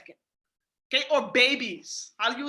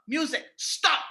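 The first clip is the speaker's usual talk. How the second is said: firm and serious to the dog; firm command; slower than usual. Shown as a demonstration. Telling the dog to stop, firm and serious. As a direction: say it like you mean it. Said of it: "he knows I'm serious".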